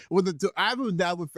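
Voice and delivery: normal talking voice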